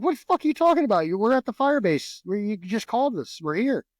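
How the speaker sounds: retold incredulous yell at the caller; exasperated retort. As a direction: fire back at the absurd accusation. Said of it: "I'm like".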